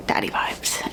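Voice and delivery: breathy whispered aside. Real, not acted. Teasing viewers about the book, whispered. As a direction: whisper it like a secret.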